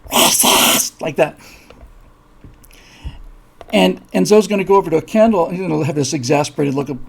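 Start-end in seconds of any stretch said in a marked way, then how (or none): 0.1-1.0 loud, voiceless rasping hiss; witch creature's hiss. Acted. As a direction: hiss it out like an accusing monster. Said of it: "the thing just goes".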